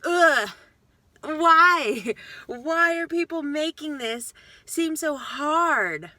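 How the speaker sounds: loud groan of disbelief, then a slow drawn-out why; fed up with diet fads, let it out; exasperated. Real, not acted. Vlogger fed up with crash diets, exasperated and loud.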